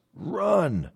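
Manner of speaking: high, drawn-out yell held back in volume; restrained mock holler; retold coach's yell. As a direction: echo a coach's shout without the force. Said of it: "He yelled simply".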